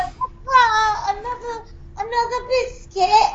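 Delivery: lightly voiced British street urchin. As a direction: beg like a poor British kid. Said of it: "Now you're like".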